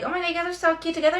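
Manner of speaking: high, fast gushing compliment; mocking a fake-nice girl gushing over a couple; mock fake-sweet girl. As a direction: gush like a fake friend, high and quick. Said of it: "Girls would be like".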